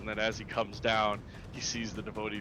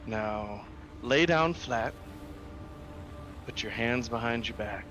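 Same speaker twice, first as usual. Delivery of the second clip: calm psychopath. Acted. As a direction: give orders like a calm killer. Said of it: "You have that serial killer voice in you".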